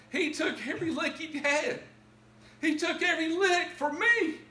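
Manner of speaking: high, loud cry of testimony, building to a sobbing shout; impassioned tearful preaching. Real, not acted. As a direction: cry it out at full force, overcome.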